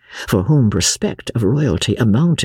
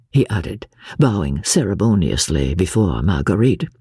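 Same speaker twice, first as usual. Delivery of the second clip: ordinary delivery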